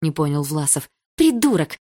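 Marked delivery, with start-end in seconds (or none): 1.2-1.8 much higher, loud furious yelling; shouted insults. A narrator's reading. Lika yelling insults at Egor, furious shouting. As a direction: yell the insults at him.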